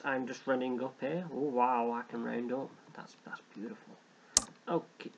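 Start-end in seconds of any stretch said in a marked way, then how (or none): 2.9-3.9 soft awed whisper. Real, not acted. Gamer admiring his member numbers, whispering. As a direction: breathe it out like a secret. Pleased aside over game numbers.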